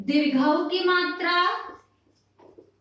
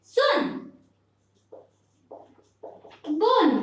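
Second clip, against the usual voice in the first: सुन, बुन with a short, quick u; short vowel; 4 semitones higher, slower than usual. Shown as a demonstration. Teacher giving children short-u examples, each said briefly. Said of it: "जो धीमे से हम बोलते हैं".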